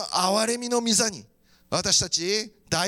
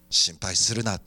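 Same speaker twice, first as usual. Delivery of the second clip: very low, calming voice of God; divine reassurance. Acted. God answering a worried believer, divine reassurance. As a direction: settle their worry in a deep, steady tone.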